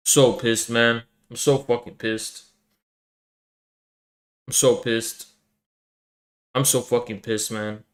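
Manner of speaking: drained, quietly furious; quiet fuming. Real, not acted. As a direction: mutter it to yourself after the blowup. After rant, sighing and fuming.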